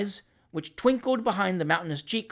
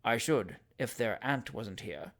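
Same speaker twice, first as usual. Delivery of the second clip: low, soft, slow flattery; a touch of passion; 5 semitones lower, 7 dB quieter, slower than usual. A narrator's reading. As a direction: murmur it close to her ear. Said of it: "with a passionate glance".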